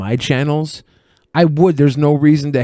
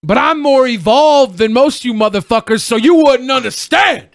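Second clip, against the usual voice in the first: high, raised-voice mock boast; mock-superior outburst; 9 semitones higher, 4 dB louder than usual. Co-host capping his own speech with a mock-superior outburst. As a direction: play-act lofty indignation, pitched way up.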